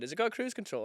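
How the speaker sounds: lightly voiced curious officer; mock curious question. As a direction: ask it in a bright high voice.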